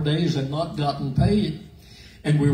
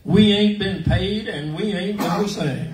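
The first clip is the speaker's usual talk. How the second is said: defiant announcement to crowd; 4 semitones higher than usual. Retold or imitated. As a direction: tell the whole crowd off. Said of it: "And she said".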